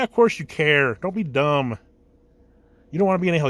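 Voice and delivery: usual speaking voice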